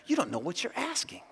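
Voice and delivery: quiet, restrained reading of a reply; soft answer. Acted. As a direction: answer them softly. Reads Jesus's answer softly.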